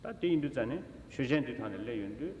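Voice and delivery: ordinary delivery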